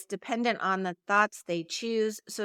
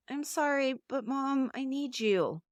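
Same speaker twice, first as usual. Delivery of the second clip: higher, softish apologetic whine to Mom; whiny; 4 semitones higher, 3 dB quieter than usual. Shown as a demonstration. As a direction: plead like a child who feels to blame. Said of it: "One's kind of whiny".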